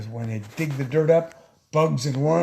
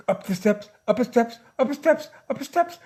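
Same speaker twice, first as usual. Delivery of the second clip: climbing the steps; 6 semitones higher than usual. Acted. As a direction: pitch stepping higher with each repeat. Acts out kids climbing steps.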